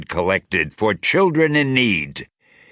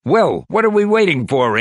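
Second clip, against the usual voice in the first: higher, louder call to lift the room; cheerful shout; 9 semitones higher, 4 dB louder, faster than usual. Acted. Ant-Man shouting to cheer up the gloomy team, cheerful shout. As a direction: shout it out brightly to break the gloom. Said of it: "Ant-Man shouted".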